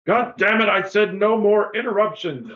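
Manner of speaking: high, loud irritated outburst; angry judge. Acted. Judge yelling at the door, angry outburst. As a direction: bark at the interruption. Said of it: "you hear a voice going".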